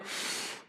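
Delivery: long audible calming sigh; deep exhale. Retold or imitated. Retelling how she sat through the lecture, heavy sigh. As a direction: let out a slow, heavy breath. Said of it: "I just went".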